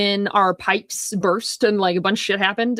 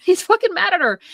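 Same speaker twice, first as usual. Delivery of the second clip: much higher, laughing in disbelief; incredulous laughing repeat; 10 semitones higher than usual. Real, not acted. Retelling the husband's anger, laughing in disbelief. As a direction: say it again like you can't believe it.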